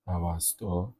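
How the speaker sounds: normal talking voice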